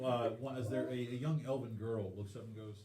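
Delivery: usual speaking voice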